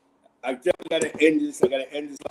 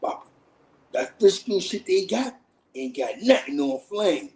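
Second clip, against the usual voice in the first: mimicking the dealer; 5 semitones higher than usual. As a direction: sell it like a street dealer, unhurried. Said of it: "He said".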